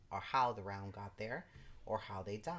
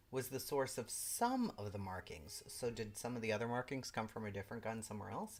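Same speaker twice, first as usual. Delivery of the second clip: ordinary delivery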